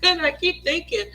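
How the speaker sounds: usual speaking voice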